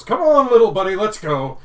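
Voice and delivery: loud, high, hearty skipper bellow; booming half-orc. Acted. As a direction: boom it out like a jolly ship captain. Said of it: "As a half-orc goes".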